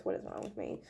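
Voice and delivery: breathless whisper to herself; self-mocking whisper over clumsy hands; whispered aside. Real, not acted. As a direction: whisper it under your breath. Fumbling the cards, muttering to herself.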